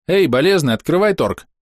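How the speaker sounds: high-pitched brisk hail; calling out. A narrator's reading. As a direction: hail him bossily. Calling out to the raging ogre.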